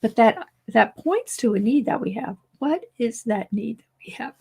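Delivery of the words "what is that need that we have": hoarse, fading whisper; losing voice. Real, not acted. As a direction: voice giving out. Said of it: "I'm still losing my voice".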